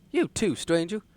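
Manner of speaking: character voice. Acted. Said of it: "I gotta get back into character".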